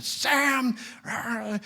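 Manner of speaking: high, held-back yell of a furious dad; mock angry yell. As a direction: imitate a dad yelling, without full volume. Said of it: "the dad comes off the porch yelling out".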